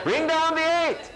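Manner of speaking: high, sustained classroom call; mock teacher command. Teacher drilling children in long division, high mock command. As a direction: chant the order high, mimicking a teacher.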